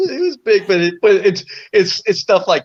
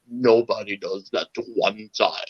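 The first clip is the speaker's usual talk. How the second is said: menacing big man; 7 semitones lower, 6 dB quieter than usual. Retold or imitated.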